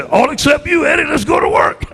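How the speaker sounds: coach's rousing shout to his staff; triumphant announcement. Retold or imitated. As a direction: call it out to the whole room.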